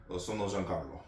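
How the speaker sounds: light Italian accent, quiet self-intro; softly in an Italian accent. Shown as a demonstration. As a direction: say it as an Italian would. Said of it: "I try to use a good accent".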